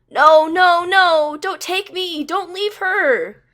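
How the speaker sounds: loud, high, desperate pleading scream; terrified scream. A narrator's reading. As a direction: scream as if being torn away. Terrified flashback cry.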